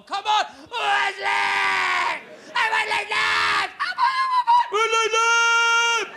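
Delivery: wild gambler screaming; out-of-control shout. Retold or imitated. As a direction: scream at the wheel like you've lost it. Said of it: "I knew I went too far".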